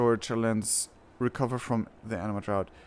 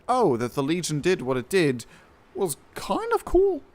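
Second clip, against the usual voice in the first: much higher put-on voice, smug and scheming; mock villain voice; 8 semitones higher than usual. Pitching a better jailer backstory, in a high put-on villain voice. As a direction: voice a villain gloating about his secret plan. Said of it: "Make it like".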